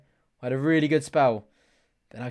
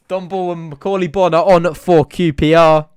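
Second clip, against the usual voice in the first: loud, higher sub announcement; excited announcing; 4 semitones higher, 12 dB louder than usual. Real, not acted. Streamer announcing the substitutes to viewers, loud and excited. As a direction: proclaim the subs.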